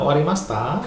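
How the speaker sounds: "Owarimashita?" with the last syllable lifted; "Owarimashita" as a rising question; rising intonation. Shown as a demonstration. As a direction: demonstrate "Owarimashita" going up at the end.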